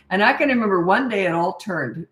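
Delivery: ordinary talk, nothing marked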